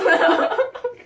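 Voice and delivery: high burst of laughter; loud giggle. Real, not acted. Laughs at own line.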